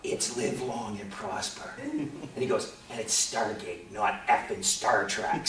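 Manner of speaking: low, slow, quiet retort; fed-up correction. Retold or imitated. Gary corrects the girl's salute, low deadpan irritation. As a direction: imitate him correcting her, slow and weary.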